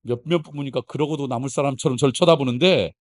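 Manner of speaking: ordinary delivery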